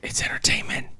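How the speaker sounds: soft whisper, no voice; whispered aside. Real, not acted. Co-host chiming in on the film, soft whisper. Aside, then repeated out loud.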